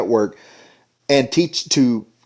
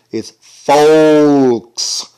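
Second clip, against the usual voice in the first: Southern folks; 2 semitones higher, 5 dB louder than usual. Shown as a demonstration. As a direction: say "folks" in a Southern drawl, jaw dropped. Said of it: "you gotta really drop that jaw down".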